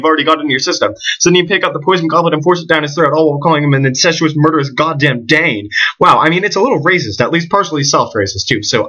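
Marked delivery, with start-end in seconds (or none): none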